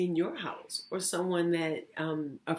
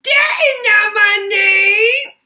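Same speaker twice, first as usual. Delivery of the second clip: much higher, much louder, slow shouted goblin; 16 dB louder than usual. Acted. Goblin taunts Lily with a deal, high slow shout. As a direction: bellow it like a gleeful goblin. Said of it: "smiled the goblin".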